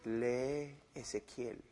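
deep, hushed, slow imagined voice of God. Pretend. Preacher imagines God telling him to read Ezekiel, deep and hushed. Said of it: "No es una voz audible que me dijo".

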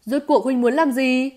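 loud, indignant outburst; angry yell. Acted. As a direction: yell the question in anger. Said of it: "tức giận hét lên". (tức giận hét lên)

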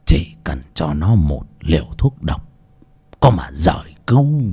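low, hushed scheming; a lightly voiced sly villain; sinister old schemer. A narrator's reading. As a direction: plot the murder under your breath, low. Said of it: "cười khùng khục, nghiến răng nghiến lợi".

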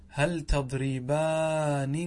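"تضربانن" with every n sounded, long aa; slow teacher's demo of "تضربانن", ending -aaninna; original form. Shown as a demonstration. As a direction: draw out "تضربانن" as tadribaaa-ninna. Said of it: "هذا بالنسبة للأول".

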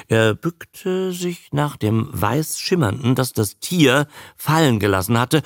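ordinary delivery

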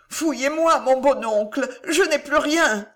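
frightened nephew's high plea. A narrator's reading. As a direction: beg him to search you, voice pitched high.

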